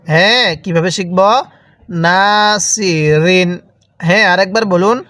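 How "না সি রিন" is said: long held "না", then "সি, রিন"; drawn-out vowel. Shown as a demonstration. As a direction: stretch "না" before "সি, রিন". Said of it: "এরকমভাবে শিখব".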